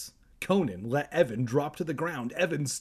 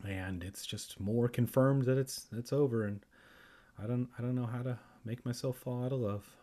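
plain reading voice

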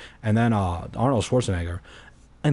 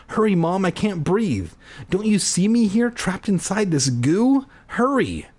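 high, panicky appeal to mom; urgent pleading; 8 semitones higher than usual. A narrator's reading. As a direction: beg for rescue as air runs out. Trapped narrator begs mother to hurry.

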